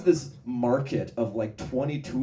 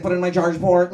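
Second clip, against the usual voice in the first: loud, higher casual request; shady car; 8 semitones higher, 11 dB louder than usual. Retold or imitated. Waymo asking passenger for coke, casual and loud. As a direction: ask it like a shady dealer. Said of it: "He's like".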